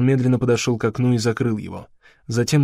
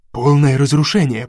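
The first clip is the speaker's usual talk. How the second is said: usual speaking voice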